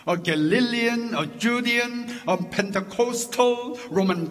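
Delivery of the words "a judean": normal talking voice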